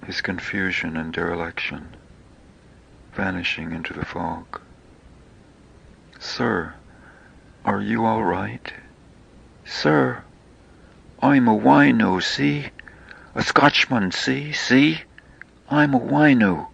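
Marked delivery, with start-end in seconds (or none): 6.2-16.7 building from mild to loud, high, pleading wino cries; drunken Scottish wino. Retold or imitated. Beggar calling out to the narrator on the street, loud pleading wino. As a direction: start polite, then get louder and higher with each see.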